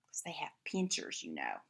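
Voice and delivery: hushed whisper to the listeners; whispered aside. Real, not acted. Reader telling the children a secret, whispered.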